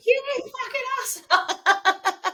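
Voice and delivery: high, slow mock insult, laughing; mock fury through laughter. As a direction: fake rage that cracks into a laugh. Voicing the simmering wife.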